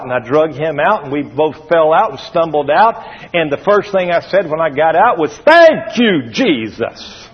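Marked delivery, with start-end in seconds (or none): 5.4-7.3 retold cry of relief, pitched up then dropping; exclaimed thanks. Retells first cry after crash.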